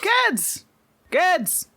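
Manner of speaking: hearty fatherly summons; dad calling his kids. Acted. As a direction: call out to the kids in a singsong rise and fall. Game master voices dad calling kids.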